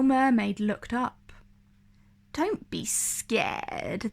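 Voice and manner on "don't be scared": slow, soft sinister whisper; slow breathy eel whisper; hissed. Acted. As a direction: hiss it like a creeping eel. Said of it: "they hissed".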